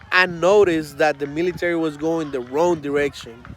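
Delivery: ordinary delivery, nothing marked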